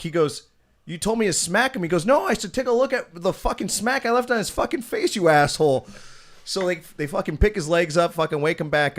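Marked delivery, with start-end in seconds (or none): 0.8-1.8 retold protest. Retold quarrel, Jeff defends himself. 2.0-5.9 high-pitched mock tirade, louder at the end; retold angry scolding. Retold boss scolding his employee.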